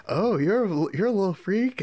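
high-pitched mock tease; teasing imitation. Acted teasing line from film scene.

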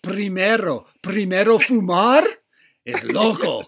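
loud, dramatic Spanish warning, hammed up; mock preachy teacher. Podcast host mocks the strict teacher, loud and hammed-up. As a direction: overplay a scandalized teacher's alarm.

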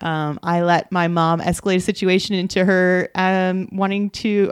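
usual speaking voice

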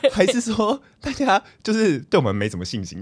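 ordinary talk

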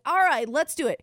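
answering the friend, pumped up; pumped agreement. Retold or imitated. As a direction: fire yourself up to sing. Finally agreeing to sing.

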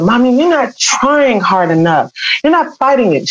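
angry plea to mother. Retold or imitated. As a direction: press her mother to fight harder, voice up. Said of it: "was mad".

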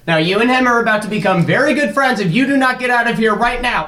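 high, fast mock threat; menacing threat. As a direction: threaten him with a hammy high voice. Threatening intruder with box cutter.

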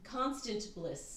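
Constant Bliss said slowly, Constant stressed; slow careful spelling-out. Shown as a demonstration. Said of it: "So it's not even Constance".

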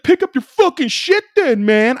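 high, loud mock outburst; imitated angry yelling. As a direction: imitate a man yelling in outrage. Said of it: "he was yelling at me".

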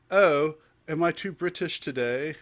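loud, high, drawn-out mock British; British accent. Shown as a demonstration. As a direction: ham up a slow British accent, pitched high. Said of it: "my extent of my British accent".

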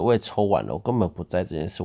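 ordinary delivery

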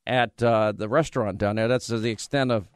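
ordinary talk

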